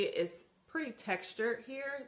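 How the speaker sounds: normal talking voice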